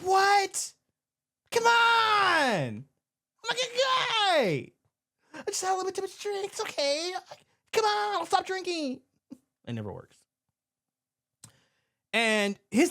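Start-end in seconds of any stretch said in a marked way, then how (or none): 0.0-9.0 put-on pleading drunk. Drunk patron pleading at ejection.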